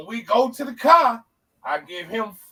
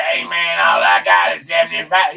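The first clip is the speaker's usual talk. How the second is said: imitating friend; 4 semitones lower, 7 dB louder than usual. Friend's usual line.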